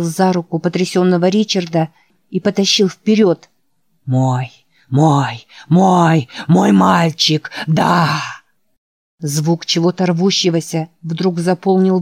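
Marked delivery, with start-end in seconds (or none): 4.0-8.2 slow, strange drawn-out monster croon; hissing serpent dragon. Acted. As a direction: savour each word like a hungry beast. Trees reaching for the boys.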